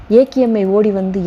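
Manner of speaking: plain reading voice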